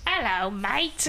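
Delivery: put-on teasing British accent greeting. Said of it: "whenever you talk to your friends, you're like".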